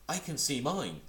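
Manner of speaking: high, loud little Roo calling out; eager cry. Acted. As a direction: shout it out like an excited small child. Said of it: "cried Roo".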